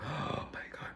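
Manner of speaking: gasp, then soft voiceless whisper; breath caught, barely voiced; overcome whisper. Real, not acted. Overcome while retelling emotional moment.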